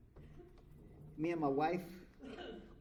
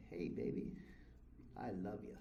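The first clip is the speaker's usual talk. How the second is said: tender murmur; 6 semitones lower, 8 dB quieter than usual. Retold or imitated. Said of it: "And I said".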